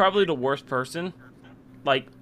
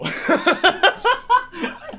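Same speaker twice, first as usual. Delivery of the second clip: high, loud burst of laughter; 5 dB louder than usual. Real, not acted. Host cracking up at his own dream story, loud laugh.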